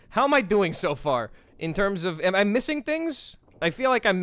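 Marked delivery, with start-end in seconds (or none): 0.1-1.3 asking through a laugh. Real, not acted. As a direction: chuckle while you ask. Laughing repeat to chat.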